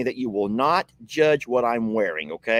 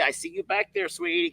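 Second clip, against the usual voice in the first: pet talk; 5 dB quieter than usual. Real, not acted. Calling to the dog Trixie.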